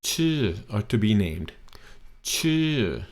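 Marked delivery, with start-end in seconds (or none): 0.0-0.6 Chu pitched high then falling. Shown as a demonstration. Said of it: "now it's falling tone". 2.2-3.0 Chu pitched high then falling. Shown as a demonstration. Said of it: "now it's falling tone".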